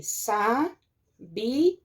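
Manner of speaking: SABIA split slowly into sa, bi, a; syllable by syllable. Shown as a demonstration. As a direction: break "sabia" into syllables with long pauses, stress on bi. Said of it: "Vamos separar as sílabas".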